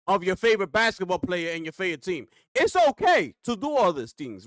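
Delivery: normal talking voice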